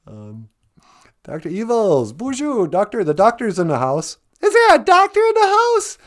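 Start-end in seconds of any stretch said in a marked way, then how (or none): 1.2-6.0 silly voices. Pretend. Silly playful character voices.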